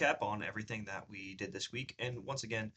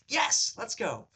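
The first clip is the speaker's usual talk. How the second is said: excited; 10 semitones higher, 5 dB louder, much slower than usual. Retold or imitated. Celebrating booking the role.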